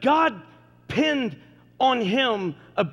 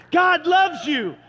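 louder, proclaimed declaration; preaching shout; 6 semitones higher, 4 dB louder, slower than usual. Real, not acted. Pastor proclaiming to the congregation, loud. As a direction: call it out to the whole room.

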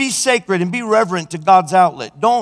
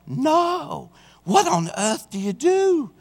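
high, slow exasperated retort; a lady asking in disbelief; exasperated question; 10 semitones higher, slower than usual. Retold or imitated. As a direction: pitch it up, drawn out in disbelief.

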